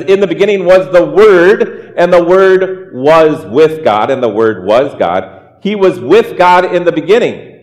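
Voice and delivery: measured, solemn reading of scripture. Acted. Pastor reading scripture to his congregation, solemn. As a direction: read the verses aloud to the congregation. Pastor reads Gospel of John.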